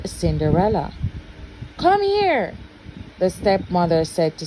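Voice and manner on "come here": high sharp summons; stepmother's call. Acted. Stepmother calling Cinderella to her, sharp and high.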